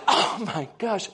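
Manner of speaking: slow, drawn-out "Oh my gosh"; playing up his own frustration; mock exasperation. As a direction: let the gosh rise in disbelief. Retold exasperation, laughing at self.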